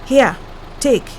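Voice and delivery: a big man handing out cash without looking; curt call from the car. A narrator's reading. Father orders from the back of the SUV, curtly and loudly.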